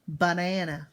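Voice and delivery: "Banana" with the chin dropping on each syllable; chin-drop syllables. Shown as a demonstration. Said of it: "How many times did my chin drop".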